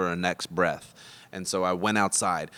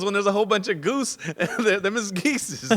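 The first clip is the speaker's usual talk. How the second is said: laughing quote; 11 semitones higher, 3 dB louder than usual. Retold or imitated. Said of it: "He goes".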